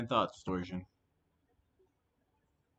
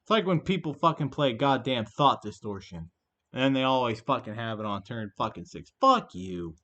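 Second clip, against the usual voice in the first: angry griping, louder; loud rant; 7 dB louder than usual. Real, not acted. Streamer griping about opponents always having the card, loud angry rant. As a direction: vent it loudly, ending on a shouted curse.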